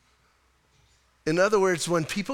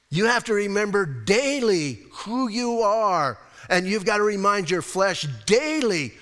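normal talking voice

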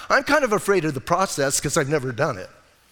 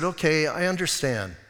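soft, calm pastoral reassurance; gentle reassurance; 6 semitones lower, 4 dB quieter than usual. Retold or imitated. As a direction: speak gently as if to a worried father. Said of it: "And I said".

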